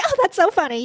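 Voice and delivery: high, put-on polite amusement; mock fake amusement. Mocking women who laugh on command, high mock gushing. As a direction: gush with fake delight. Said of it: "We're like".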